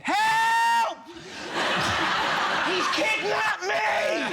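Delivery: mock hysterical scream through laughter; panicked scream. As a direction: scream like a kidnapped mum. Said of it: "my mum genuinely went".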